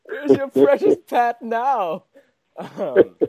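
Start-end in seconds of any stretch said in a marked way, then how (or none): 0.0-2.0 high, slow hammed-up gloating; mock villain taunt. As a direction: draw out the taunt like a pantomime villain. Mock villain taunting about absent Pat.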